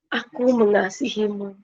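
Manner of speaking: soft, tender voice in the ear; very gentle voice. Retold or imitated. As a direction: imitate a gentle, soothing unseen speaker. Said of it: "Itu lembut banget". (suara lembut penuh kasih)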